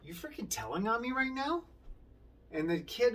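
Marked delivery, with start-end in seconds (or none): 0.0-1.6 mock outraged accusation, pitched up high; accusing inmate. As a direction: play the offended con catching a snitch. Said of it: "Terry, would be like".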